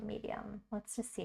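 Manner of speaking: whispered to herself; soft voiceless aside. Real, not acted. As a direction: breathe the word out without voice. Choosing brush, to herself.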